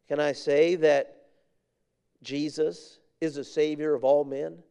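usual speaking voice

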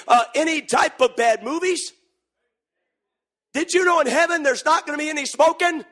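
high, loud preacher's build; fiery preaching climax, rising and loud; preaching at full force. Acted. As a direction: preach it up to a shout.

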